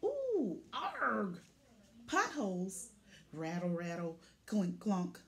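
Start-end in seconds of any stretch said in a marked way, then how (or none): 0.0-1.4 high ooh then a grunt; straining ooh and arg; surprised strain. Acted. Truck jolts on long load.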